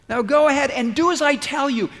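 high, loud, booming command; a forceful divine command; commanding God. Acted. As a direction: order him to go, no arguing. God commanding Moses, voiced.

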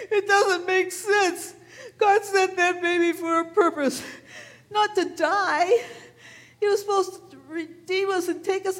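high, tearful pleading, voice breaking into sobs; crying. Acted. Shepherd crying to the Lord over Jesus's death, tearful and high. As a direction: cry out to God as if the world just ended.

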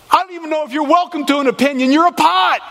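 mock-divine shout; shouted rebuke. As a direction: bark it down at the pot. God rebuking the pots.

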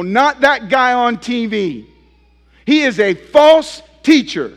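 rising to a ringing accusation; emphatic denunciation. Real, not acted. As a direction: call him out from the pulpit. Preacher denouncing TV preachers.

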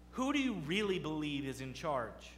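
ordinary talk

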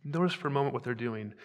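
ordinary talk

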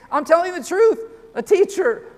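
preacher driving a quote home; emphatic insistence. Acted.

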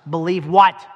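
sudden preacher shout; shouted rhetorical question. Real, not acted. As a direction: fire the question at the crowd.

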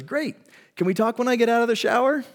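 high, quick, polite brush-off; lightly voiced wife asking to wait; wife cutting in. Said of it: "the first time she goes".

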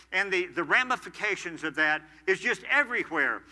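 loud, rising preacher's emphasis, pressing each word; preaching at full force. Acted. Pastor urging husbands, preaching hard and loud. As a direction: drive home equal partners to the church. Preacher warning about anger.

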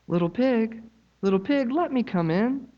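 high, put-on wolf voice; sly wolf. Wolf at the straw house door, high sly voice. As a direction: coax like a sneaky cartoon wolf. Wolf coaxes third pig.

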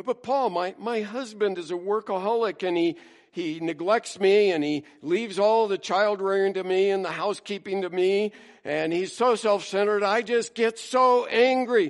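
mock-whiny, begging Paul to understand; hammed-up grievance; whiny complainer.

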